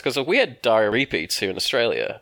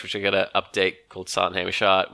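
ordinary delivery

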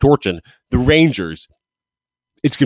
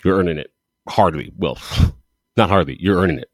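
ordinary talk, nothing marked

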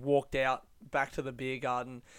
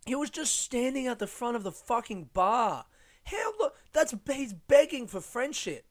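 disgusted; 9 semitones higher than usual. Real, not acted. Mocking pathetic guy at bar.

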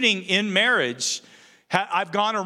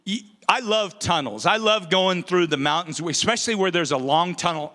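usual speaking voice

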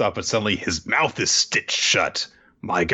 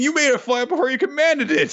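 high-pitched outraged protest; indignant accusation. Acted. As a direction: cry foul in a much higher voice. Accusing racer in retold scene.

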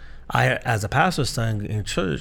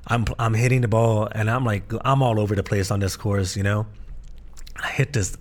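ordinary talk, nothing marked

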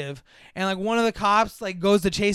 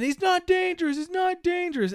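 put-on high, insistent kid's plea; mock urgent protest; pleading kid; 11 semitones higher than usual. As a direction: imitate a child pleading, pitched way up. Kid runs in pleading for alien.

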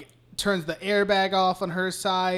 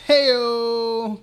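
higher, louder drawn-out call; jokey punchline whoop; 4 semitones higher, 6 dB louder than usual. Real, not acted. Host capping his own pun, jokey whoop. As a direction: sell the pun with a big heyo.

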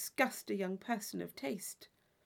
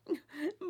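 short high laugh; quick rising giggle; light laugh; 7 semitones higher than usual. Acted. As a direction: laugh off her own fervour. Isabella laughs.

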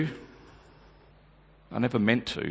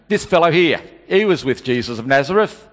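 a louder, pointed call-out; insistent accusation; 4 semitones higher, 7 dB louder than usual. Retold or imitated. Man at the gate outing Peter, loud insistent call. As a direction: point him out to the crowd. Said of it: "someone else at the gate insisted".

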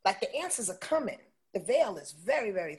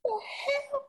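much higher, squeaky mock shock; startled outburst; 11 dB louder than usual. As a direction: jump out of your skin in disbelief. Startled brain reacting to spirit.

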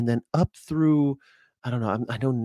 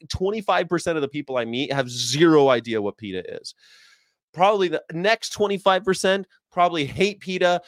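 ordinary talk, nothing marked

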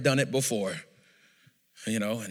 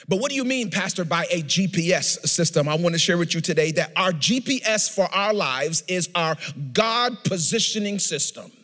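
raised, emphatic sermon voice; preaching; 5 semitones higher, 4 dB louder than usual. Real, not acted. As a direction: press the point home to the congregation. Preaches to congregation.

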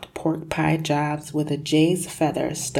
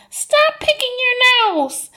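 scolding bystanders; 8 dB louder than usual. Acted. People scolding the stuck boy.

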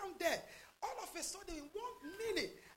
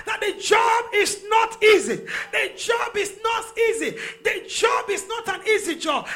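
shouted declarations building to a louder peak; full-force preaching; 6 semitones higher, 27 dB louder than usual. Real, not acted. Preacher declaring freedom over the congregation, full-force preaching. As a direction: proclaim it forcefully, like a fiery preacher.

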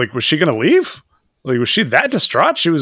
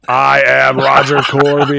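loud, slow declaration near usual pitch; Roger Corby mock-belting; 5 dB louder, slower than usual. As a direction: announce it grandly, stretching it out. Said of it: "while Roger screams".

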